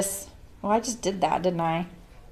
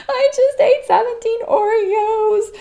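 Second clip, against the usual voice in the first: much higher, slow mock-tearful whine; whiny wail; 4 dB louder, slower than usual. Pregnant daughter crying to her mom on the phone, high slow whine. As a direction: wail it like a pregnant woman confessing. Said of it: "I'd be like".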